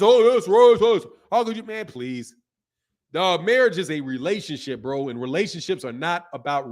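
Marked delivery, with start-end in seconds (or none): 0.0-1.6 mock outrage. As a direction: ham up the offended girl. Mocking the offended girl again.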